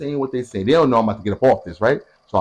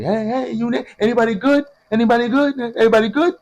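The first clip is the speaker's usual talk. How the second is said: much higher, overly friendly mom greeting everyone; 11 semitones higher than usual. Retold or imitated. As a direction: play the sweet hovering mom. Mom checking on visitors.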